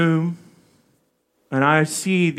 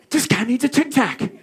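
laughing; 7 semitones higher than usual. Real, not acted. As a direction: laugh through the punchline. Laughing at own joke.

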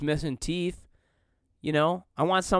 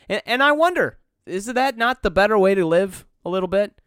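worked up; 5 semitones higher, 5 dB louder than usual. Real, not acted. Musing rant rises up.

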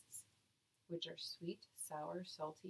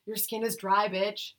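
sassy; 4 semitones higher, 6 dB louder than usual. Pretend. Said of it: "that's supposed to be a yoga, and that was not yoga".

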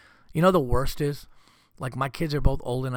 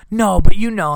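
higher, much louder exasperated retort; exasperated wife; 7 semitones higher, 14 dB louder than usual. Retold or imitated. Wife blaming her husband, loud exasperated retort. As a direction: snap back with exasperation.

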